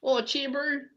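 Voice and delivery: high New Zealand bloke voice; New Zealand accent. Pretend. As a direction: put on a high Kiwi accent. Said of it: "Try a New Zealand accent".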